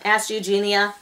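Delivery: ordinary delivery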